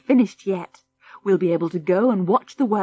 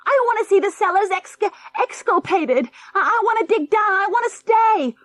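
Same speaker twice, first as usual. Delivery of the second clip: put-on high, loud pleading boy's whine; the boy pleads with his father, whining; whining child; 14 semitones higher, 6 dB louder than usual. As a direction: beg like a spoiled boy. Junior keeps wailing to stay.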